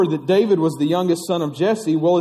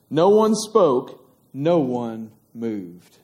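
slow hushed suspense; 7 semitones lower, slower than usual. A narrator's reading. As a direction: let the silence sink in slowly. Suspense pause in story.

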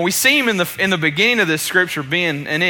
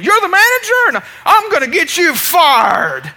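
imitated yelling; 11 semitones higher, 5 dB louder than usual. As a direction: yell it like an irate customer, stretching the end. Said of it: "after he has yelled at her".

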